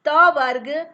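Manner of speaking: त वर्ग called out loud; sounding out letters. Shown as a demonstration. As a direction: call त वर्ग out clearly and loudly. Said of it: "मतलब हो गया ली". (त वर्ग का ज़ोरदार उच्चारण)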